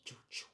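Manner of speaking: soft whispered bazooka sound; whispered weapon noise. Retold or imitated. Storyteller imitating big homie's bazooka, whispered sound effect.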